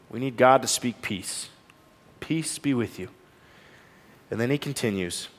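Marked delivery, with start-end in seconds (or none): 2.2-3.1 soft, hushed, gentle and much quieter; quiet tender comfort; tender blessing. Acted. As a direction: speak peace over a frightened friend. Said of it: "the tenderness in Jesus".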